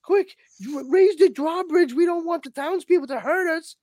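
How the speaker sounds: mock cowering guard shrieking orders; panicky guards. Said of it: "they're just like".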